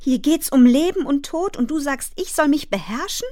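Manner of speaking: put-on high, tearful child's indignation; outraged cry. A narrator's reading. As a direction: argue back in outrage, pitch held high. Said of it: "schrie Fern". (empört und aufgebracht, mit hoher Stimme)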